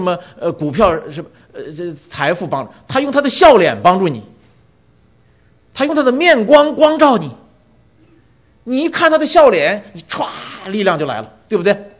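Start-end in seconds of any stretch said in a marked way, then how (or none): 2.9-10.3 rising, loud preaching on his shining face; fervent preaching. Acted. Preacher exalting God's face to the congregation, loud and high. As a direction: lift the voice to proclaim it.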